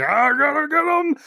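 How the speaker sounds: very high, strained mock wail; mock crying. Reteller playing the amorous bed-spinning guy, high mock crying. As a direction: imitate a man crying out in a squeal. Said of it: "he's really just crying like".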